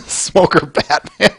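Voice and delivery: real laugh rising into a high squeal. Real, not acted. Streamer laughing at chat's Batman joke. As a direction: laugh it off, pitch climbing.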